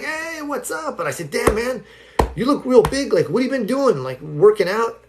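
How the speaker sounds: high-pitched, hyped-up bro admiration; feigned amazed greeting. Retold or imitated. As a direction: put on impressed surprise, pitched well up. Acting amazed at friend's physique.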